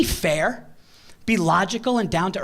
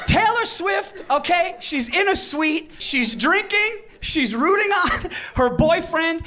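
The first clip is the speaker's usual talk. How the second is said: emphatic defense; 9 semitones higher, slower than usual. Real, not acted. Defending [name] emphatically.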